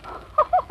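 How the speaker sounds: high, amused laugh; scoffing laugh. Acted. Wife laughing at husband's grounds for divorce, scoffing. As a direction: laugh off a silly accusation. Laughs at his accusation about Ferdy.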